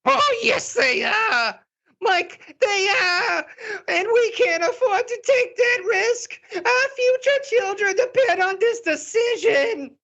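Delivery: very high, sobbing drama queen shout. Acted. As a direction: cry it out like a frantic diva. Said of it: "this is my drama queen voice".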